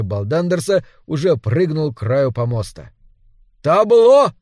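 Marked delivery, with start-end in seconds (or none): 3.6-4.3 shouted stage command. A narrator's reading. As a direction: yell it out high and loud. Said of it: "крикнул он". (громкий выкрик)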